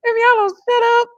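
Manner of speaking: shouting at the TV. Real, not acted. Yelling at cast on TV.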